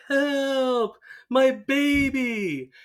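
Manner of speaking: high, drawn-out damsel wail; mock cry for help. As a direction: imitate a woman calling for rescue. Retold woman calling for rescue.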